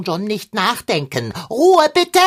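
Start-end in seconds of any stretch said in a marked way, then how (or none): 1.5-2.2 loud, high call for silence. Acted. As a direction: shout them down to stop the squabble. Cat silences quarreling Figarinos.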